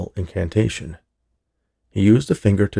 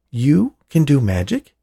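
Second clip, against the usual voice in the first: surprised; 7 semitones higher, 4 dB louder, slower than usual. Acted. Hal stunned the robot did magic.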